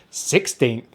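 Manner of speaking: ordinary talk, nothing marked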